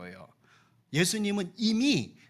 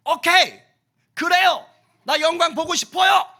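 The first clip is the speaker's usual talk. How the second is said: high, loud eager agreement; eager Martha; 11 semitones higher, 11 dB louder than usual. Acted. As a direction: jump at the promise of glory.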